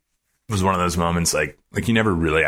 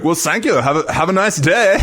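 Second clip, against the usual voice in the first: high, louder imitation of a German-tinged accent; Reinhold Messner's accent; 11 semitones higher, 4 dB louder than usual. Famous climber bidding a fan goodbye, imitated German accent. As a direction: sign off in the climber's accent, pitched way up. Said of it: "I'm gonna do his accent injustice".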